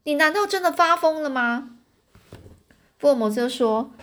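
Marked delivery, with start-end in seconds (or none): 0.0-1.8 higher-pitched indignant question; storyteller's raised-pitch angry challenge. A narrator's reading. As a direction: act out mildly outraged disbelief. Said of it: "这老公爵又很生气地说".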